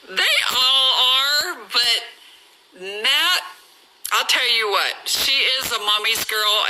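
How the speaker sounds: normal talking voice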